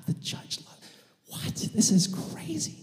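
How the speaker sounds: whispered, breath-only astonishment rising high; hushed, incredulous acted whisper; stunned disbelief. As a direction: whisper it as if shocked. Crowd stunned by judge's love.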